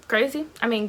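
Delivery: normal talking voice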